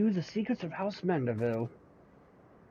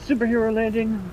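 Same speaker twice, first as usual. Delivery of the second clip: loud, higher hype call; excited quip; 8 semitones higher, 7 dB louder than usual. Real, not acted. The streamer hyping the character's dramatic entrance, loudly. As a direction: announce it like a fanboy.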